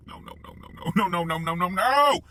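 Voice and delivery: imitated rising panic, rapid repeats; acted panicked denial. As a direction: whisper building to a high, loud cry. His mounting denial, acted out.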